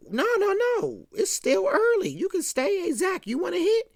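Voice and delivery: high-pitched imitation of a persuasive host; mock-eager, voice lifted high; coaxing. Johnny coaxes them to stay.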